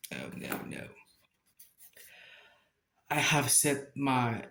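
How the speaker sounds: usual speaking voice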